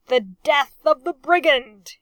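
loud, sneering toast; sneering cry. A narrator's reading. Captain crying a sneering toast against his enemy. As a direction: spit it out with contempt, lip curled. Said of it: "he cried, his aristocratic lip curled in a sneer".

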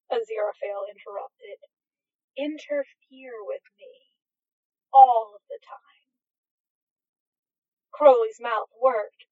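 plain reading voice

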